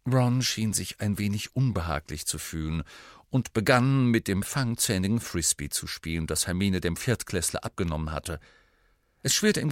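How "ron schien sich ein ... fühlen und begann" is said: usual speaking voice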